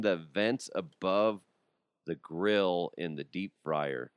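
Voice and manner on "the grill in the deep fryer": ordinary talk, nothing marked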